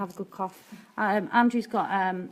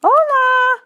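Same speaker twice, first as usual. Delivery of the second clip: Hola! sung out much higher and much louder; exuberant shout; 15 dB louder than usual. Shown as a demonstration. Answering a viewer's Hola with an exuberant shout. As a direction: fling the Hola! out like a party greeting. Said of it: "Helen's put".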